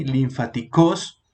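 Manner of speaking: "linfáticos" stressed at the end, lin-fa-ti-CÓS; wrong stress on final syllable. Shown as a demonstration. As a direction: slowly land the stress of "linfáticos" on COS. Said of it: "No lleva la, el acento ni en la I ni en la O". («linfáticos» lento, mal acentuado al final: linfatiCÓS)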